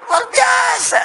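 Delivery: high, loud rallying shout; real, rousing shout of amazement; exclaiming shout. Real, not acted. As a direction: proclaim it at full voice to the crowd. Roused exclamation to the crowd.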